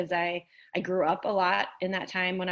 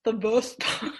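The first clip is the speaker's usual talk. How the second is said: deep man voice; 3 semitones higher, slower than usual. Acted. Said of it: "I will get a deep voice going".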